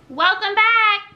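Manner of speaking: put-on high, slow babyish welcome; childish voice. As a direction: play the silly kid voice. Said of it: "I'm being childish".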